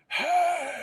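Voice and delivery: sudden high barked Hey; angry yell. Shown as a demonstration. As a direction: yell it to scare kids off. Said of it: "pretty good at doing the whole angry yell that scares 'em".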